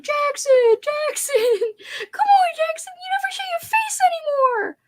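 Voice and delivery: very high mock whining plea; squealed, playful pleading; imitating fans calling. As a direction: beg the dog like an adoring fan. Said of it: "Everyone's like".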